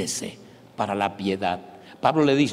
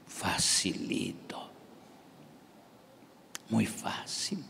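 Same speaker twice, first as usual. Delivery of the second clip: mock whisper; 3 semitones lower, 11 dB quieter, much slower than usual. Sarcastic hushed aside.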